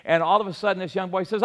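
ordinary talk

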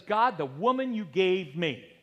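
slow, loud accusing whine; complaining Adam. Retold or imitated. Preacher voicing Adam complaining to God. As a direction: blame it on her, aggrieved. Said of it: "he began to complain".

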